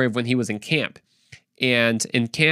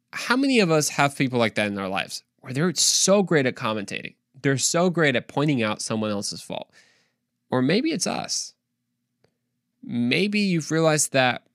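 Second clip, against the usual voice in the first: ordinary delivery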